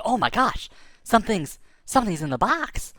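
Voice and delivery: as if something just moved in the box; startled gasp. Retold or imitated. Boy spotting movement in a box, startled.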